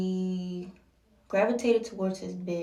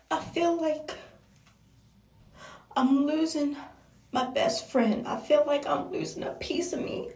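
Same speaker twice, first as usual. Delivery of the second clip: high, tearful voice, halting between phrases; choked-up confession; 7 semitones higher than usual. Real, not acted. Telling him she is losing her best friend, choked up. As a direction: push the words out through rising tears. Tearful retelling of breakdown, choking up.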